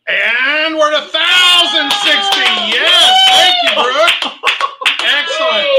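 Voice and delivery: shouting the new bid, pitch climbing high; excited shout. Real, not acted. Auctioneer to the room, thrilled by a jump in bidding, shouting. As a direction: cheer the big bid out, loud and high.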